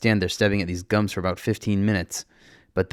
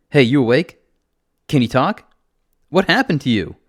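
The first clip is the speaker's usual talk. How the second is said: loud, raised rousing questions; urgent calling; 6 semitones higher, 9 dB louder than usual. Acted. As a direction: call out to rouse an injured stranger. Urgently rousing injured man.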